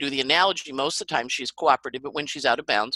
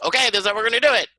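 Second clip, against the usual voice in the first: higher, louder and fast, laying down the plan; mock commander; mock bossy take-charge; 7 semitones higher, 5 dB louder, much faster than usual. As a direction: snap into a brisk bossy planner. Acting a take-charge Gemini.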